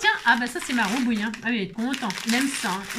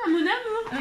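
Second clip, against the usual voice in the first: pet talk; 10 semitones higher, slower than usual. Real, not acted. Talking tenderly to her pet.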